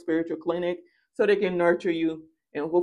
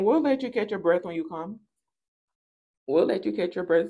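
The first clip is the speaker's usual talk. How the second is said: usual speaking voice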